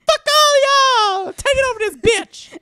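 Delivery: very high, slow, screeching yell; wild yelling entrance. Retold or imitated. Storming into the room to taunt everyone, wild screeched yell. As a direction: scream it like storming in. Retold rowdy entrance cry.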